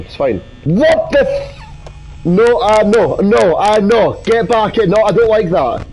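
ordinary talk, nothing marked

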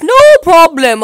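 ordinary talk, nothing marked

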